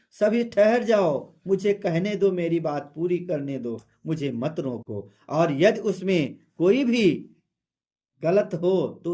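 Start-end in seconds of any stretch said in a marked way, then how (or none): none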